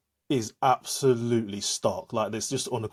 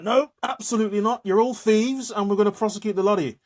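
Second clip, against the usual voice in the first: high, loud imitation of stern officials; imitating an outraged accuser; indignant mock accusation; 10 semitones higher, 5 dB louder than usual. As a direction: act out a blunt refusal and threat. Retold accusation by the post office.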